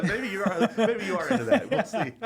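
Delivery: high, loud, talking through laughter; real laughter breaking into the words; laughing. Real, not acted. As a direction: laugh hard while teasing. Laughing banter about creepy hallway.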